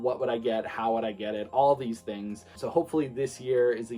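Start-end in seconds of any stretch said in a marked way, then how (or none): none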